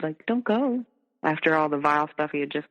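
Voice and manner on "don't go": put-on much higher, whiny little-kid plea; childlike pleading. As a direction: beg like a small child. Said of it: "like a little kid?".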